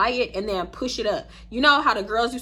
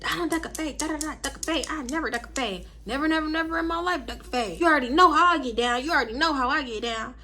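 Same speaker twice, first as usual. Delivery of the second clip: mild mock tough-girl brag; 4 semitones higher than usual. Imitating Nisha's bragging.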